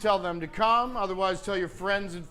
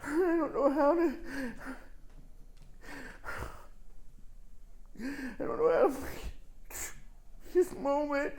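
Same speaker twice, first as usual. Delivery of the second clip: choked up; 3 semitones higher, 7 dB quieter than usual. Real, not acted. Addressing late mother, emotional.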